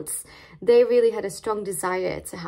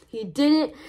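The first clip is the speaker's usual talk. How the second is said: overjoyed parents; 5 semitones higher, 3 dB louder than usual. Retold or imitated. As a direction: gush with joy like thrilled new parents. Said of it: "they were so happy, praising the Lord".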